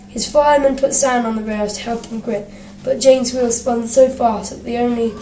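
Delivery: usual speaking voice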